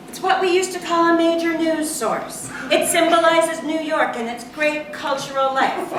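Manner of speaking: proud, high classroom declaration; lecturing teacher. A narrator's reading. Teacher voiced explaining to her class.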